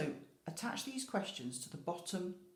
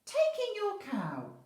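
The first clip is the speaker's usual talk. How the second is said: put-on high, loud, slow old man voice; a squeaky sing-song old man; wise old man; 9 dB louder, slower than usual. A narrator's reading. Wise old man advising the lady, high and slow. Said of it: "said the wise old man".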